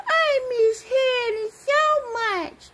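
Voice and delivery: high, slow mock-sad toy voice; crying Mickey Mouse. Toy mouse missing Hannah, high pretend crying. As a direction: draw it out in a high pretend whine. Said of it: "Mickey Mouse go".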